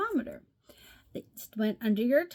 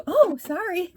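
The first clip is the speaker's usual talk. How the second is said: mom apologizing; 8 semitones higher, 6 dB louder than usual. Retold or imitated. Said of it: "And she's like".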